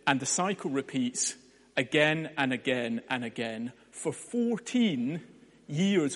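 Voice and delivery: normal talking voice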